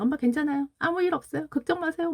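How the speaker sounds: a child gently reassuring his mom, lightly voiced; imitating her son. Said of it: "막 이렇게 얘기를 한 거예요".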